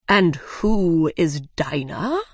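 slow dodo question with a steep final rise; dodo character voice. Acted. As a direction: ask it ponderously, swooping up at the end. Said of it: "the dodo asked".

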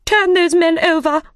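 high, loud queenly order; angry command. A narrator's reading. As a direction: order the Knave about angrily. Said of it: "turned away from him angrily".

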